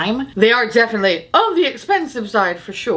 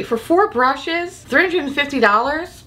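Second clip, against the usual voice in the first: incredulous; 6 semitones higher than usual. Real, not acted. Outraged at brush set price.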